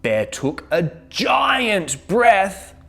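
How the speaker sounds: plain reading voice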